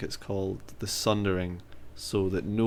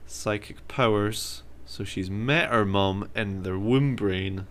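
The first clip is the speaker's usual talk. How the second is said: slow, deliberate; much slower than usual. Real, not acted. Mock-serious lore explanation.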